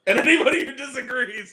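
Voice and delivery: loud, emphatic burger verdict; loud declaration. Real, not acted. Loud rant to podcast partner about burgers. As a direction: proclaim it to the room.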